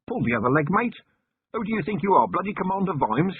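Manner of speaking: a bargeman mocking the claim; demanding stranger. A narrator's reading. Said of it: "A vague silhouette demanded".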